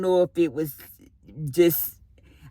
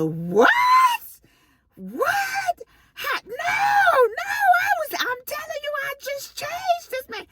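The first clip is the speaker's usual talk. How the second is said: high-pitched shriek of disbelief; retold shrieking protest; shocked disbelief. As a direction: cry out in frantic denial, voice shooting way up. Retold shock at patient's death.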